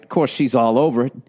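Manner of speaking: ordinary delivery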